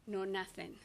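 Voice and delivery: ordinary talk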